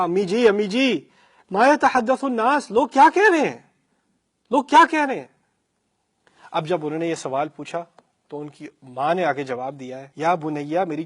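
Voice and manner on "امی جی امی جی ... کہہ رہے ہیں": tearful plea. A narrator's reading. Daughter weeping to her mother, high loud tearful plea. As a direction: beg through sobs what people are saying. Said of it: "رو رو کر میں نے کہا".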